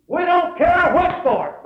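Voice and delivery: loud, high preacher's shout; defiant proclamation. Real, not acted. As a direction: belt it out defiantly to the congregation. Preacher proclaims the boy's answer.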